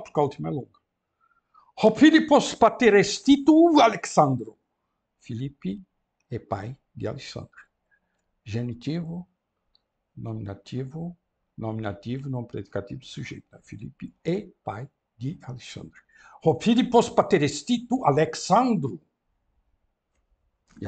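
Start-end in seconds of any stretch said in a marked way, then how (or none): 1.8-4.6 grego declamado, alto e agudo; leitura em voz alta. Shown as a demonstration. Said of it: "reads Greek aloud for class". 16.4-19.0 declamado um pouco mais alto; leitura em voz alta. Shown as a demonstration. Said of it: "reads Greek aloud for class".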